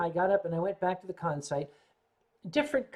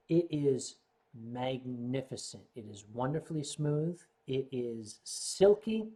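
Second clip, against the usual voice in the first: savouring; 6 semitones lower, 5 dB quieter than usual. Real, not acted. Savouring praise of sake.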